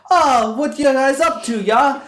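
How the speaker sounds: loud, slow, high fake British; mock British accent. Parodying her chat with the band, loud mock British accent. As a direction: send up her British act, loudly. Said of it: "had a conversation in British".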